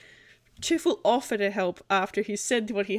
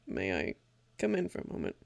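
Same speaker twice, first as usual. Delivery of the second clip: hushed request; 13 semitones lower, 9 dB quieter than usual. Acted. Leaning closer, fears being followed.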